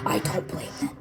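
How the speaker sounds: soft unvoiced whisper. A narrator's reading. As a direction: breathe it out quietly. Dark admission in narration.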